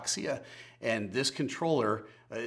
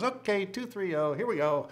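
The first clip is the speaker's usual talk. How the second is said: high, jolly slurred imitation; town drunk; 9 semitones higher than usual. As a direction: ham up a giddy, slurring pilot. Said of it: "He goes".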